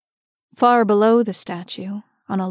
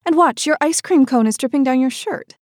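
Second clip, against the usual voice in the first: mother; 5 semitones higher, 3 dB louder than usual. A narrator's reading. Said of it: "replied the mother".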